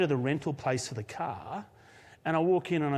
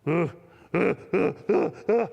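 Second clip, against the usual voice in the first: louder, halting huh-huh laughter; mock old man laughing in bursts; old man's chuckle; 3 dB louder than usual. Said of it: "he just went".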